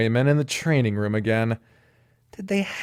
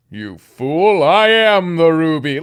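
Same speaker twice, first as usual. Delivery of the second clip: pompous demon snake; 3 semitones higher, 6 dB louder, slower than usual. Acted.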